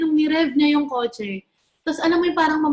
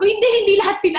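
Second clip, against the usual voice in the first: emphatic denial; 6 semitones higher, 5 dB louder, much faster than usual. Retold or imitated. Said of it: "Sabi ko".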